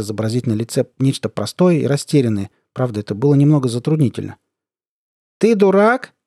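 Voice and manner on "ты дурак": high, loud challenge; loud question. A narrator's reading. Colonel leaning to the narrator's ear, loud. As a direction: ask loudly, pitched up. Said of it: "громко спросил полковник".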